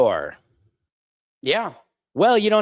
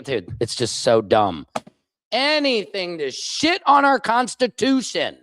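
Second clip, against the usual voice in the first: ordinary talk, nothing marked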